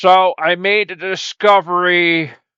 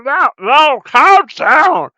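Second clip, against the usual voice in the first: higher, louder urging; loud calm-down; 8 semitones higher, 6 dB louder, slower than usual. Acted. One character loudly telling the other to calm down. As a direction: shout at him to settle down. Calming panicking partner.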